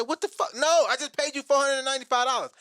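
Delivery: outraged. Retold or imitated. Outraged at agency.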